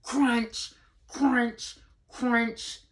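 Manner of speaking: high, playful crunching sound words; reading to a toddler. Acted. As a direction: make the snow crunch for the kids.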